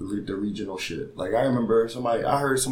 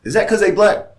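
high, loud mock-incredulous question; show segment host; 12 semitones higher, 9 dB louder than usual. Mimicking the show's segment, high loud questioning. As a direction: ask it pitched way up, like a provocative host. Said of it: "that segment is basically going".

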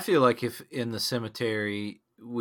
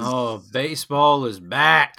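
drawn-out joy; 4 semitones higher, 9 dB louder, slower than usual. Acted. Drawn-out joyful exclamation.

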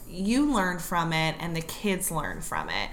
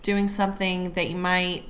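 ordinary talk, nothing marked

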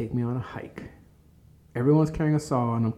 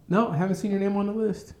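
high-pitched phone brush-off; offhand rejection; 9 semitones higher, 3 dB louder than usual. Retold or imitated. Hiring office answering an applicant's call, high-pitched brush-off. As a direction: turn the caller down in a raised pitch.

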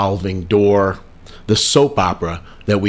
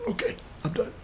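muttering relieved; 5 semitones higher, 16 dB quieter, much faster than usual. Real, not acted. Finishing, muttered aside.

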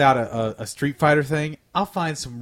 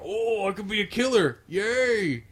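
crazy fan voice; 7 semitones higher than usual. Retold or imitated. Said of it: "And they go".

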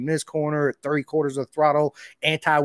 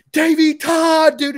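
much higher, louder, slow and drawn-out fanboy squeal; 10 dB louder, slower than usual. Retold or imitated. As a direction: squeal his name like a starstruck kid. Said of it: "my fanboy instantly was like".

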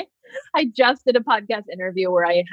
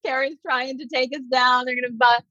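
mock panic; 6 semitones higher than usual. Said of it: "and they're like".

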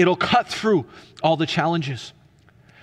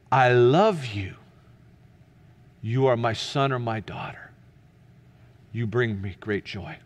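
still small voice; 5 semitones lower than usual. Acted. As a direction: calmly repeat the love, with long pauses. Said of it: "what that still small voice says".

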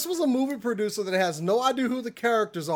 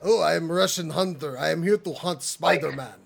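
hammed-up cartoon Russian accent, a bit lower; mock a Russian villain in a cheesy Russian accent; bad Russian accent; 4 semitones lower than usual. Podcaster mocking Kraven the Hunter in a bad Russian accent. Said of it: "that is horrible Russian accent".

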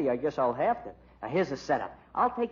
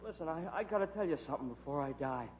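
faint, much softer gasp of a dying man; dying whisper; 2 semitones lower, 11 dB quieter, faster than usual. Acted. Shot informant telling reporter, faint dying voice. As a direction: barely get it out, life fading.

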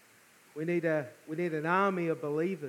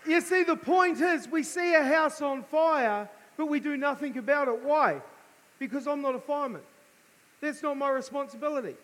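fervent preaching; 8 semitones higher than usual. Acted. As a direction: preach it hard to the room. Preaching at full force.

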